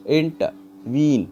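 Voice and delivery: "Intervene" slow, inter held apart, weight on वीन; stress on last syllable. Shown as a demonstration. Teacher demonstrating an anapest, stress landing on वीन. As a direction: pause after inter, then press on वीन. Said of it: "वीन बोलते समय pressure आ रहा है".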